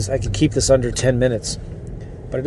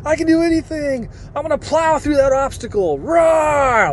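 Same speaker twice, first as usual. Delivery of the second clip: very high, hammed-up fired-up voice ending in a roar; mock a pumped listener, like a Marine; 11 semitones higher than usual. Podcast listener fired up by Sean Terry, hammed-up high voice with a roar. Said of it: "people listen to Sean Terry".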